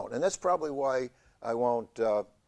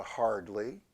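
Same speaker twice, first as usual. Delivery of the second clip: ordinary talk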